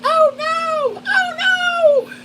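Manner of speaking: very high squeal of alarm; panicked worm. Pretend. Trader voicing the scared worm, very high squeals.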